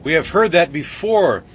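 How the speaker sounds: impatient rebuke; indignant. A narrator's reading. Bellman scolding the baker, mildly indignant. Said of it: "the bellman indignantly said".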